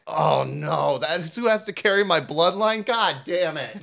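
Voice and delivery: hammed-up, high-pitched exasperation; mock dismay. Royal dad dreading who carries his bloodline, mock dismay. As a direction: wail it in comic despair, ending near a laugh.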